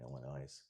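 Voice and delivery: "Illinois" said low and soft with a z at the end; final S sounded. Shown as a demonstration. Commentator musing on how locals say it, "Illinois" with final z. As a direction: voice the last S of "Illinois", quietly. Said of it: "Do you say Illinois or Illinois?".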